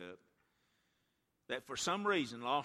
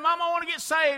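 put-on child pleading; 18 dB louder, faster than usual. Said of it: "he kept saying".

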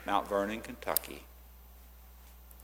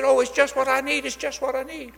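delighted teacher; 13 semitones higher, 6 dB louder, faster than usual. Retold or imitated.

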